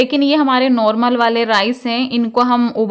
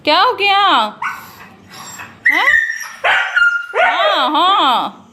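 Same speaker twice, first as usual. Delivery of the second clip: high pet talk; 9 semitones higher, slower than usual. Real, not acted. Fussing over the sick dog.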